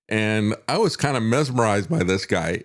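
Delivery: ordinary talk, nothing marked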